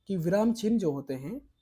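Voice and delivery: normal talking voice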